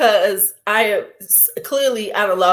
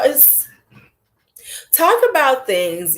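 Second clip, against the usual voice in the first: normal talking voice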